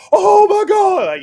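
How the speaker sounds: very high, loud, slow yell across water; drawn-out high shout of disbelief; excited shout. Retold or imitated. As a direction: scream it like you just saw a giant fish. Buddy yells across the lake.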